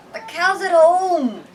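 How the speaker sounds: put-on high, loud, slow sneering boy; second boy's voice. Second boy mocking Kell to his friends, high loud sneer. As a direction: stretch it out mockingly like a village bully. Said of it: "replied a second boy's voice".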